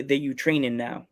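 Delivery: usual speaking voice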